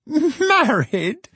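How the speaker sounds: high, laughing, incredulous "Married?"; scornful laugh. A narrator's reading. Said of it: "cried Filer with a groan".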